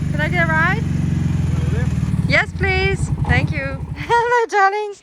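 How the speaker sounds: high, loud, cheery greeting; cheerful calling out. Real, not acted. Calling to farmer and animals.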